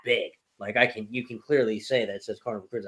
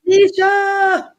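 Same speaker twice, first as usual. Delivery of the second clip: high, loud, drawn-out shout of a name; calling out; 9 dB louder, much slower than usual. Real, not acted. Host hollering a greeting to Tanisha, calling out.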